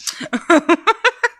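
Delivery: short bright laugh at her own joke. Real, not acted. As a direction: burst out laughing.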